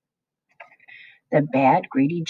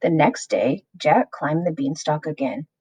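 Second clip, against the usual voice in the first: ordinary delivery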